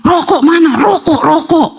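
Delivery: high, lightly mimicked demand for cigarettes; mock possessed yelling. Possessed husband waking at night to demand cigarettes, high mock yelling. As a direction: imitate a possessed man calling out. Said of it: "teriak-teriak".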